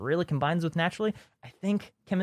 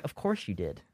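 unimpressed wife; 10 semitones lower, 8 dB quieter than usual. Retold or imitated. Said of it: "she's like".

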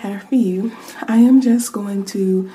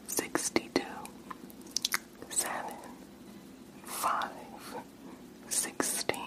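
faint far-off whisper into one ear; distant test whisper; soft whisper; 20 dB quieter, slower than usual. Shown as a demonstration. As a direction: breathe each word softly into the ear. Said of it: "me whispering in your ears like the last one".

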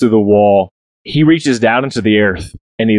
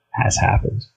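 whisper; 2 semitones lower, 7 dB quieter than usual. A narrator's reading. Narrator drops to hush.

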